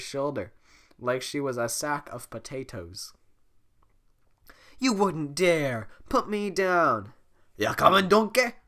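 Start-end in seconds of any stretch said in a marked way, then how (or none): 4.8-7.1 slow, loud outraged commands; protest, outraged and loud. Acted. Fiona carried off. 7.6-8.5 loud gruff call. Acted. Voicing Shrek.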